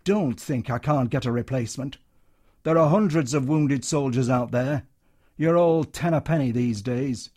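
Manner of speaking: loud, bullying tirade; hectoring. Acted. Troop leader berating a soldier, hectoring and loud. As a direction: belittle him, he's replaceable. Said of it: "The hectoring voice went on".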